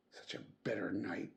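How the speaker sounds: hushed, fast whisper; whispered aside. A narrator's reading. Narrator whispers about the bitter cold night. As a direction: breathe it out as a shivering aside.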